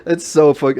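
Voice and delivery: high, loud and breaking into laughter; laughing. Retold or imitated. Retelling own reaction, laughing.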